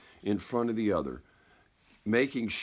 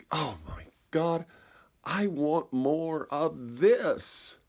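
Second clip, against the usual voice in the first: awed longing; 5 semitones higher, much slower than usual. Retold or imitated. Awe at the vast sky.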